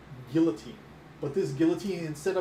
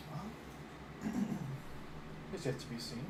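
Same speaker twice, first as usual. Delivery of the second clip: quiet in character; 9 dB quieter, slower than usual. Acted. GM voices Laurie's reply.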